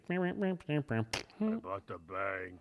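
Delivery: very slow, put-on Batman voice. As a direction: drag it out as Batman. Repeating Batman line in voice.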